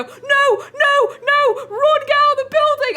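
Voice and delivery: high, loud panicked yell; frantic high-pitched re-enacted warning; retold screaming. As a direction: scream at a friend to flee. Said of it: "where I was screaming".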